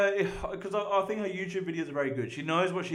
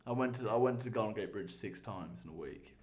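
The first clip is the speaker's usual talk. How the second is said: ordinary delivery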